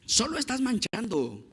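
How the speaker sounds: high-pitched, soft mock scolding; scolding father. Father tells his son he's smudging, mock scolding. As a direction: imitate a dad chiding his little boy. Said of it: "su papá lo regaña".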